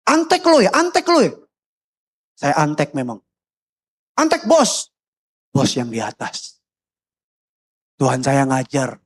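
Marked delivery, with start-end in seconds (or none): 0.1-1.4 high-pitched, repeated jeer; mocking accusation. Acted accusers taunting the speaker. 4.2-4.9 high, mocking taunt; jeering accusation. Acted accusers taunting the speaker.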